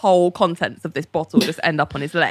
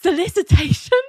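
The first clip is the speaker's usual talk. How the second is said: high, incredulous query; mock shocked disbelief; 11 semitones higher than usual. Ali hearing the charge by phone, mock shocked.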